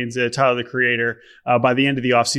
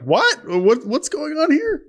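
baffled onlooker; 13 semitones higher, slower than usual. Retold or imitated. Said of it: "if people are like".

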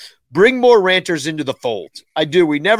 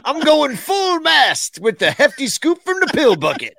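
mock rallying shout; 7 semitones higher than usual. Repeating the tweet louder.

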